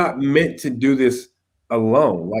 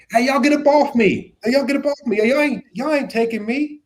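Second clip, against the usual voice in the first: hammed-up high defiant yell; mock street-fighter voice, higher pitch; mock brawler Jesus; 10 semitones higher than usual. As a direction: play a tough fighting off arrest, comically. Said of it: "He wasn't like".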